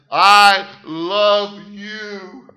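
drawn-out, loud and earnest I love you; tender declaration. Retold or imitated. Sergeant tells the young soldier he loves him, slow and loud. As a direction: pour it out slowly, full of feeling. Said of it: "He said".

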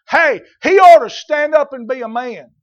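loud, high exhortation; preaching shout. Real, not acted. Preacher exhorting fathers to be men, loud. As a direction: bark it at the congregation.

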